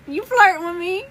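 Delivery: high-pitched, flirty teasing question; coy mock challenge, pitched well up; playful teasing. Real, not acted. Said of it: "I'm just teasing".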